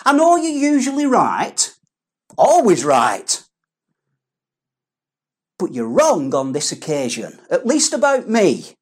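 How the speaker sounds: a touch of a swaggering Peter; cocky Peter. Acted. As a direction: brag to Jesus like a know-it-all. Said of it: "what Peter's actually saying is".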